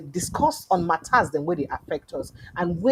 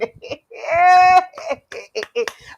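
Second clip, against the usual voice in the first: high rising laugh; 8 semitones higher, 6 dB louder than usual. Real, not acted. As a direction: laugh it off mockingly. Laughing at sweep them clean.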